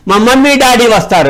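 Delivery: put-on high child voice; little boy. Schoolboy tells the stranger his parents come, in a child voice. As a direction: chirp it like a small boy. Small boy answers the narrator.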